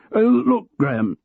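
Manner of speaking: a sudden loud, high call to break the gloom; unnecessarily loud. Acted. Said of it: "unnecessarily loudly".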